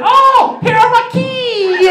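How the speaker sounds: very high, slow falsetto; mom finding the kids. Retold or imitated. Mother seeing her kids run up, high slow falsetto. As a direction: sing out the relief up high. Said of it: "she said".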